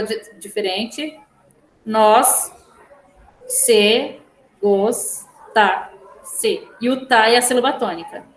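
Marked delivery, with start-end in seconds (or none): 1.8-6.7 "nós, se, gosta, se" with a pause after each syllable. Shown as a demonstration. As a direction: dictate "nós, se, gosta, se" one beat at a time. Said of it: "vocês têm que separar".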